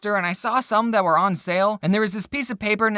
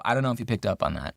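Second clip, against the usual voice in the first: muttered aside; 10 semitones lower, 5 dB quieter, faster than usual. Acted. Muttered aside after dark joke.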